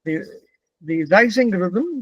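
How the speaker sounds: normal talking voice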